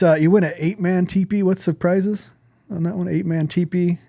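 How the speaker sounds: ordinary talk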